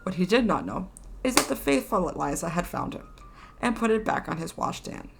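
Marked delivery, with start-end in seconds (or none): none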